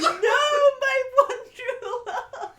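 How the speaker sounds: high, slow, wailing cry of lost love; overwrought wail. Acted. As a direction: howl it like a heartbroken melodrama hero. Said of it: "Bikini Matt goes".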